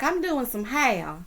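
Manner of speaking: mock distressed southern belle, lingering on each word; damsel in distress. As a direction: imitate a damsel in distress, stretching the words. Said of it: "She always talking like a damsel in distress".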